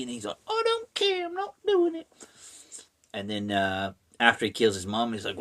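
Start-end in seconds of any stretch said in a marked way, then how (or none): 0.5-2.1 very high squeaky falsetto; hammed-up whiny defiance; mock stubborn refusal. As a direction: protest in a cartoonish falsetto. Voicing Steven refusing again.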